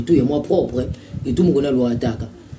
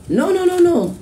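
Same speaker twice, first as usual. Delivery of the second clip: emphatic no; 12 semitones higher than usual. Real, not acted. Refusing a rumour, sharp high emphatic no. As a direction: shoot the no's up high in protest.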